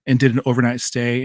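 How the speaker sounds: usual speaking voice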